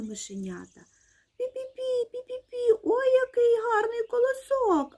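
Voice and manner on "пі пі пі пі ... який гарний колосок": very high tiny mouse voice; high-pitched admiring mouse squeak; squeaking mice. A narrator's reading. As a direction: squeal in delight like little mice.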